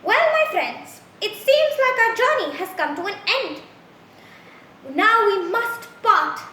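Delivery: lofty, haughty high voice; high and mighty. A narrator's reading. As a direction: talk down grandly like a pompous aristocrat. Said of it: "spoke to the food in a high and mighty sort of voice".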